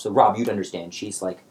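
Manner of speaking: ordinary delivery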